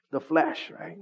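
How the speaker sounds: normal talking voice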